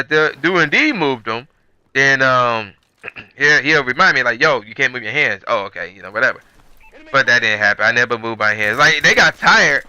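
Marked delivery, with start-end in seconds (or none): none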